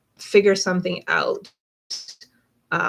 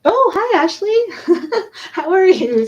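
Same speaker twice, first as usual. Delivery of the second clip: excited greeting; 12 semitones higher, 9 dB louder than usual. Real, not acted. Greeting a returning viewer.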